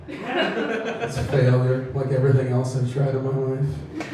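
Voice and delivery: a put-on sad sigh of a line; mock sad. Comic telling crowd his life failed, mock sad. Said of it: "That sounded so sad".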